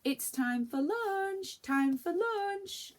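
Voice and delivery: high, loud, slow drawn-out call; sing-song calling. Acted. Mother calls the children for lunch, sing-song. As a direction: stretch it out so they hear.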